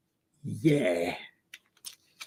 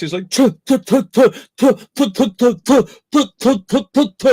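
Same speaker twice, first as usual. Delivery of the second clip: higher, louder spitting sounds; mock spitting noises; 7 semitones higher, 5 dB louder than usual. Friend imitating Chinese spit fighters, mock spitting. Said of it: "have spit fights".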